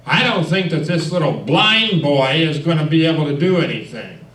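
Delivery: dismissive leader. Retold or imitated. Scout leader talking down to the new boy, loud. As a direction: belittle the blind boy in front of his friends. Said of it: "and he said".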